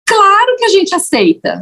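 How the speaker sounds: high, lightly imitated eager acceptance; eager yes. Shop women answering eagerly.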